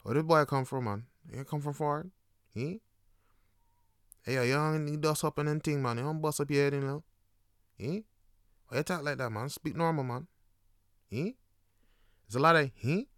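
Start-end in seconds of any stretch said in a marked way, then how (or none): 0.0-11.4 puzzled Jamaican Patois, with questioning grunts; Jamaican local. Acted. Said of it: "everyone will know, it's like". 12.7-13.1 short rising Patois "He?"; puzzled rising Jamaican grunt; Jamaican questioning grunt. Acted.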